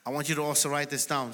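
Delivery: usual speaking voice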